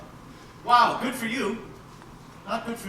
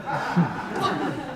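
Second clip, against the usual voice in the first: laughing; 9 semitones higher than usual. Acted. Confession of being rich.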